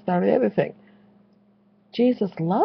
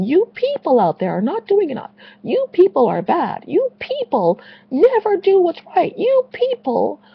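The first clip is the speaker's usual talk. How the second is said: mock ranting accuser; 11 semitones higher, 4 dB louder than usual. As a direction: rail at the flock like a finger-pointing preacher. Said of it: "I think I'm done ranting".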